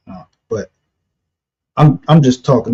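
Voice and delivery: ordinary delivery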